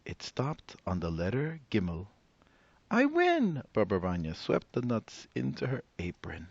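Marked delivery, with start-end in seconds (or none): 2.9-3.6 high excited cry of winning; triumphant old woman. Acted. Old woman winning at dreidel, high and gleeful. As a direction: crow over the win.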